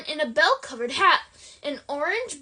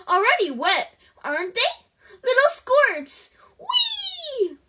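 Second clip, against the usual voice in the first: gleeful poltergeist; 7 semitones higher than usual. A narrator's reading. Peeves taunting soaked students, high and gleeful. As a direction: swoop up into a giddy squeal.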